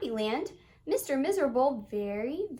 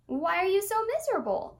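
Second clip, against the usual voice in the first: bright question; 7 semitones higher, 4 dB louder, faster than usual. Acted. Happy asks brightly.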